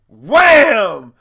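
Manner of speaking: sudden, very loud impact word; acted-out slap. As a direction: shout it like the smack landing. Acting out the slap landing.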